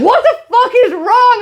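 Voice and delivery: high, loud mock-alarmed cry; retold alarmed outburst. Woken partner to the screaming dreamer, retold alarmed outburst. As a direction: imitate a startled bedmate, loud and high. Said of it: "He's like".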